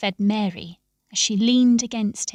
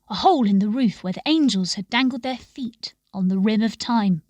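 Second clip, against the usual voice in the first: ordinary delivery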